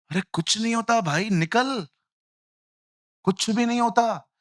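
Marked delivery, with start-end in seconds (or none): none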